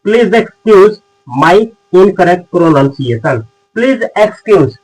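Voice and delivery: each word stretched very slowly; very slow model phrase. Shown as a demonstration. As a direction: dictate it for learners to copy. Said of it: "तो आप बोल सकते हैं".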